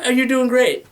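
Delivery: high, loud gushing trainer praise; excited coach praise. Retold or imitated. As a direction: pump them up like an aerobics host. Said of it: "They're like".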